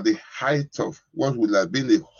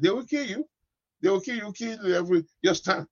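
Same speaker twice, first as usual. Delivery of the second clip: sudden high-pitched cry of warning; 8 semitones higher than usual. Acted. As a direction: jump up high to warn them. Warning listeners who keep quiet in battle.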